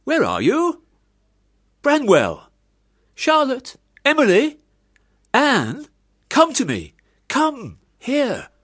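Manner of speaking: loud, high calls shouted across the house; calling out loudly. Acted. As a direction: summon every child by name at full voice. Father summons children loudly.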